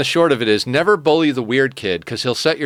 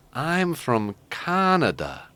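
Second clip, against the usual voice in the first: put-on slow, dopey Canadian accent, drawn out; Canadian kid drawling slowly; much slower than usual. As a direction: play the slow Canadian kid, stretching each word. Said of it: "the Canadian kid looks over and says".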